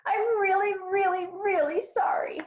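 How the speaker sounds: high, tearful pleading wail; crying child. A narrator's reading. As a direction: plead through tears, high as a little girl. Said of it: "Harriet cried".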